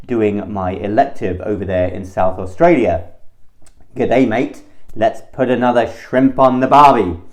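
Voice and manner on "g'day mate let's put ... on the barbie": hammed-up Aussie accent, a bit louder; cheesy Australian tourist line in Aussie accent; playful mock Australian accent. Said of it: "That's my Australian accent".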